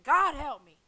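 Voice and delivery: loud, high plea for help; desperate cry. Retold or imitated. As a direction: call out to God. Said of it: "I was like".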